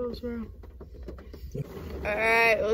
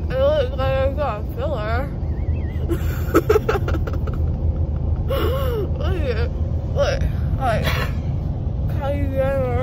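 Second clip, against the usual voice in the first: loud, loopy and giddy; groggy from anesthesia; 8 dB louder than usual. Real, not acted. Drugged patient, loud and giddy.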